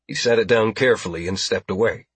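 usual speaking voice

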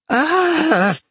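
very high, loud "Ah!"; outraged cry. A narrator's reading. Peg outraged at his taunt.

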